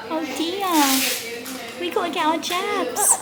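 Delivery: high, slow, soft cooing. Real, not acted. Mum to baby awaiting jabs, high sympathetic cooing.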